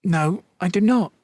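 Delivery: put-on high, loud flustered young woman; embarrassed girl. A narrator's reading. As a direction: blurt it out, flustered.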